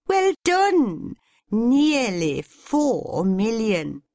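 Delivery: high, slow gushing praise; delighted. A narrator's reading. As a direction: gush with delight. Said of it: "clapping her hands".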